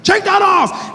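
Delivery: high, loud barked order; mock-angry agent, high and loud. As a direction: snap at him to strip. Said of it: "more and more angry".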